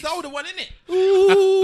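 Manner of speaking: high laughing tease. Real, not acted. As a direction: laugh through the jab.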